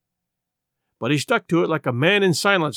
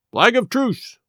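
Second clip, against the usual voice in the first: someone calls from outside, higher-pitched call; 6 semitones higher, slower than usual. A narrator's reading. Someone outside announces a truce, calling out. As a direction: call it out high, like a herald.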